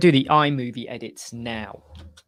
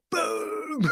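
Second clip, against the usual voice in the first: much higher answering boom; high reply call; 13 semitones higher, 3 dB quieter than usual. Retold or imitated. Coworkers answering his catchphrase, high gleeful reply. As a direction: pop it back high and playful. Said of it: "they'd be like".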